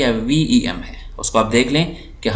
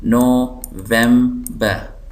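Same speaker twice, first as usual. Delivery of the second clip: November very slowly as NO, vem, ber; syllable by syllable; much slower than usual. Shown as a demonstration. Teacher showing three syllables, November slowly split. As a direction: break November into three spaced beats. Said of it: "तीन syllable".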